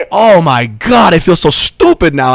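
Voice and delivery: drawn-out, high, loud mock dismay; hammed-up embarrassment, stretching the big words; mortified stranger, imitated. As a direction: groan it slowly like someone realizing his blunder. Stranger's embarrassed realisation acted out.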